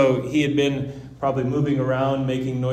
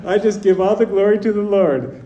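laughing; 8 semitones higher, 6 dB louder than usual. Retold or imitated. Retelling own reply, still laughing.

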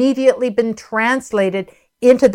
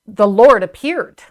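usual speaking voice

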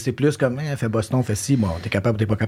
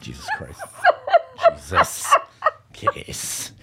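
words squeezed out through laughing; real helpless laughter; slower than usual. Real, not acted. Co-star teasing her about the forgotten role, helpless laughter. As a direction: swear breathlessly while cracking up.

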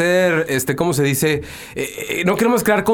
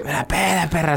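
softer mock owl taunt; 4 semitones lower, 4 dB quieter than usual. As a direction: sneer it back as the owl. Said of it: "la lechuza les dice".

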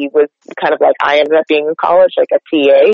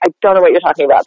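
usual speaking voice

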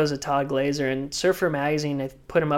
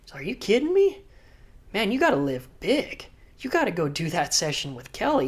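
high, pumped-up buddy voice; excited urging; 5 semitones higher than usual. Retold or imitated. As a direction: hype him up to go. Friend urging him on, retold.

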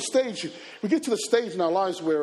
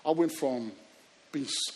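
ordinary talk

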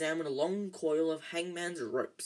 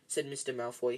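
ordinary delivery

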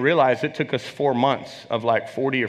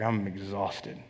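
much softer, lower, worn-out admission; retelling the moment he broke down to his pastor; broken; 6 semitones lower, 10 dB quieter, slower than usual. Retold or imitated. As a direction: let it fall out of you, spent. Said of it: "And I just broke".

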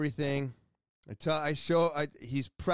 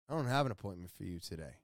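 low, soft, quick mumble of bad news; imitating an apologetic front-desk worker; hesitant receptionist; 8 semitones lower, 6 dB quieter, much faster than usual. Receptionist telling a patient there is no appointment, hesitant. Voicing receptionist turning them away.

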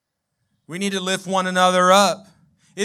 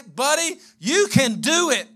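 higher, slow, cheering a buddy on; slow, heartfelt pep talk, pitched up; encouraging friend; 5 semitones higher, slower than usual. Retold or imitated. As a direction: lift him up like a loyal friend. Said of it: "We need somebody to say".

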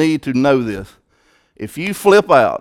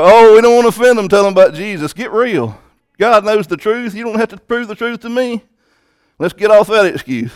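higher, pressing rebuke; fiery preaching; 5 semitones higher, 3 dB louder than usual. Acted. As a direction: scold the excuse-makers. Preaching at full force.

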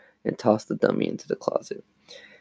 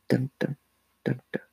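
soft heartbeat dun dun; 2 semitones higher, 7 dB quieter than usual. Pretend. Heartbeat sound effect.

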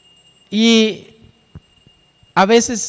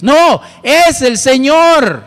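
higher, louder and slow, forceful; emphatic declaration; 6 semitones higher, 6 dB louder, much slower than usual. Real, not acted. Preacher correcting the congregation's doubt, forceful proclamation. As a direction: proclaim it with full conviction.

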